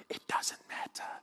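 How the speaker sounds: breathy whispered aside. Acted. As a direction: drop to a whisper to let it land.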